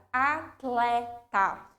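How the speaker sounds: "atleta" very slow, T and L sounded; slow careful word. Shown as a demonstration. Teacher showing the word slowly. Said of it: "o exemplo da palavra".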